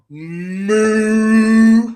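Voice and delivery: loud, long drawn-out moo; mock cow moo. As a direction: moo loudly like a cow to taunt. Mock animal noise taunting a coward.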